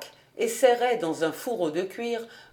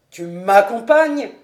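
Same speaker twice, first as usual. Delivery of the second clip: loud, delighted surprise; joyful exclamation; 8 dB louder than usual. A narrator's reading. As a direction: cry it out joyfully, louder. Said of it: "s'écria Hahn d'une voix joyeuse". (s'écrier d'une voix joyeuse)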